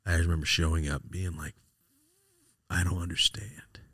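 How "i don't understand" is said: slow, breath-only bewilderment; stunned whisper. Retold or imitated. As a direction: whisper it slowly, lost in shock. Retold shock after the death.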